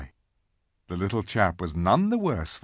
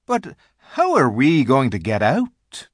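higher, louder character voice; worried faun's question; 4 semitones higher, 6 dB louder than usual. A narrator's reading. Tumnus asks how to leave the locked castle, puzzled. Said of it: "Tumnus, I think, first said".

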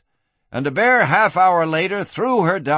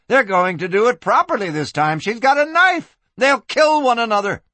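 high, loud, panicky warning; alarmed cry; 9 semitones higher, 4 dB louder than usual. Acted. Madam backs away from the knife fight, alarmed cry. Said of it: "she cried, backing away".

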